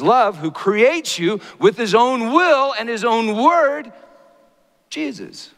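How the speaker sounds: high, loud impassioned preaching; preaching at full force. Acted. Preacher building to a climax before the congregation, impassioned. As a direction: lift the room with a soaring sermon voice.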